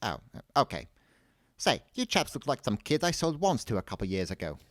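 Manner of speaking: put-on high-pitched old man character voice; old wand seller. A narrator's reading. Old man recognising past customers, chatty.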